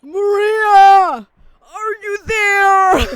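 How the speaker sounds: loud, slow, Batman-like rasp; hammed-up brooding hero shout; Shadow voice. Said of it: "I tried doing a Shadow, uh, voice and it was just Batman".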